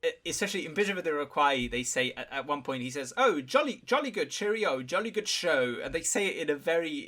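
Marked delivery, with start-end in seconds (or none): 3.2-5.8 mock Queen's English accent, pitched higher; extremely high Queen's English. Quoting a British officer in a hammed-up Queen's English accent. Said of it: "it's this extremely high, like, uh, Queen's English accent".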